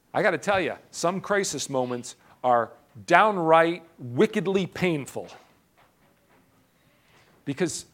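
ordinary talk, nothing marked

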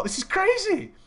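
high-pitched incredulous squeal; mock shock. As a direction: exclaim it in exaggerated disbelief. Retold shocked thought.

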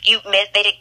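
usual speaking voice

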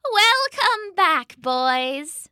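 high, slow motherly welcome; lightly voiced mother; Mama's greeting. A narrator's reading. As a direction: greet them sweetly as Mama. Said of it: "she said".

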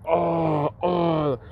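a touch of hurt moaning; pained groans. Retold or imitated. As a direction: imitate dad groaning with flour in his eyes. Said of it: "fucking all pissed off".

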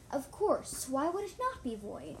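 mock them with a hint of menace; taunting. A narrator's reading. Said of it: "in a taunting tone".

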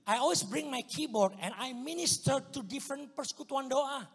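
normal talking voice

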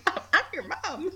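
high, slow mock-mom scolding; mock stern mom. Owner scolding her bossy cat in a mock mom voice. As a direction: put the cat in its place as its mother.

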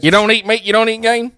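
mock hillbilly accent. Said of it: "Something about eating game meat".

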